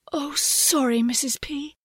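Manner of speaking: high, sheepish apology; apologetic. A narrator's reading. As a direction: back down sweetly, embarrassed. Mother apologises.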